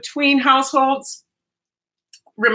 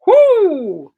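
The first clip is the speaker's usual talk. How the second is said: excited whoo; 8 semitones higher, 6 dB louder than usual. Real, not acted. Excited cooking exclamation.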